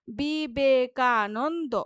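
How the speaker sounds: বিবেকানন্দ drawn slowly into five beats; syllable by syllable. Shown as a demonstration. Teacher counting syllables of বিবেকানন্দ, slow. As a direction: split বিবেকানন্দ beat by beat. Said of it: "যতবার আমরা এরকম মানে উচ্চারণ করব".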